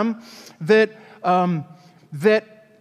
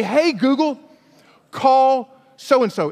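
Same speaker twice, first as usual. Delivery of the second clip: commanding the phone; 4 semitones higher, slower than usual. Shown as a demonstration.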